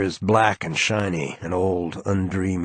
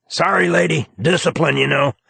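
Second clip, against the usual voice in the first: higher, breezy camp apology; A J's voice; 8 semitones higher, 4 dB louder than usual. A narrator's reading. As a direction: toss it off lightly as if nothing happened. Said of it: "A J".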